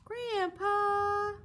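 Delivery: high, loud call for grandpa. Acted. Boy calling out to his grandpa in alarm. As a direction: shout across the room for help.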